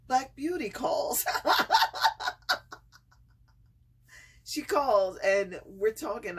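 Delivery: high, loud burst of real laughter; laughter bursting out of her; laughing fit. Real, not acted. Friend calls mid laughing fit.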